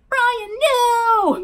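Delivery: very high, loud wailing shout; anguished yell. A narrator's reading. Maskey seeing Hoodie netted, anguished yell. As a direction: wail it out, stretched and desperate. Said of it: "Maskey yelled".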